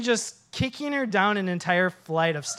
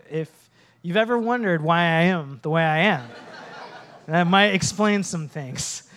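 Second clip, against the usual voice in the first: ordinary delivery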